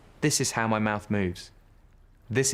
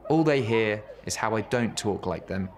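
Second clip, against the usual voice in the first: ordinary delivery, nothing marked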